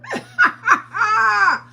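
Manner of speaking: 大声の高笑い; loud high laugh. Real, not acted. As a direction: belt out a loud high cackle. Teasing viewer about husband.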